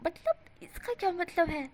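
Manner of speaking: hammed-up stunned question; shocked. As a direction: let the shock stretch each word. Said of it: "वो सच में shock था".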